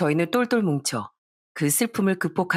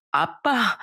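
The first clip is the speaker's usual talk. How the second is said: higher, crying out through tears; sobbing cry; 5 semitones higher, much slower than usual. Acted. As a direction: call out while sobbing. Daughter crying to her father.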